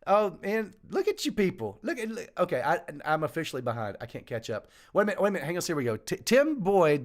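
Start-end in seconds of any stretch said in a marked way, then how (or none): none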